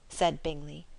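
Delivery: ordinary delivery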